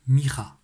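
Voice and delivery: mira said loud with a drawn-out final a; new word mira. Shown as a demonstration. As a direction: call out mira, stretching the last a. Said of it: "on le dit".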